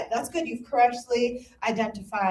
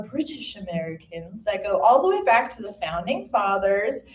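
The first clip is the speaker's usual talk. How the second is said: usual speaking voice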